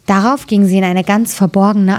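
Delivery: ordinary delivery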